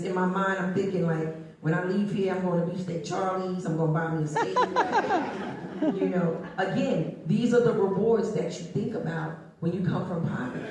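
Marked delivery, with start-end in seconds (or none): 4.6-5.9 high, giggling laugh. Real, not acted. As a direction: giggle at the memory. Laughs about the steak dream.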